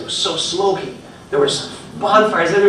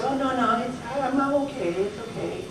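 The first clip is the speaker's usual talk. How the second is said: quiet, higher, fading reassurance; soft self-denial; 5 semitones higher, 10 dB quieter than usual. Retold or imitated. Speaker voicing people in denial, soft. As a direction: mutter that it's fine. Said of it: "We just say".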